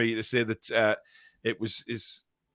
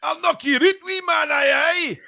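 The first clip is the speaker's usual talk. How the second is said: high, loud hammed-up Scottish threat; shouted mock Scottish accent; 5 dB louder, slower than usual. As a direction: bark it like a cartoon Glaswegian hardman. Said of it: "better than your Scottish and French".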